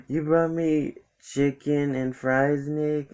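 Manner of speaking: high, drawn-out imitation of a friend; high-pitched mock complaint; mock dismay. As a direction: whine it slowly, way up high. Imitates friend's reaction to the food.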